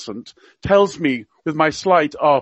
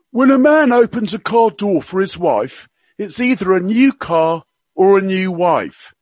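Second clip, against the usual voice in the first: higher, hammed-up Cockney accent; Prince Philip in Cockney; 3 semitones higher, 4 dB louder than usual. Radio guest quoting royal gaffes to listeners, mock Cockney accent. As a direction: play the old duke's gaffes in mock Cockney. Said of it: "he spoke with a Cockney accent".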